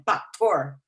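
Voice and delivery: "factor" said as "paktor", p for f; accented mispronunciation. Shown as a demonstration. Teacher giving an example, "factor" as "paktor". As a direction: swap the f of "factor" for p. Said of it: "Kunyari sinabi mong factor".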